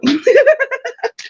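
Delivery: sharp high burst of laughter; a real high cackle; high giggle at himself. Real, not acted. Laughing at his joke.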